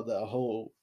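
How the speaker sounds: ordinary talk, nothing marked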